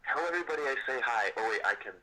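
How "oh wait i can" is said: normal talking voice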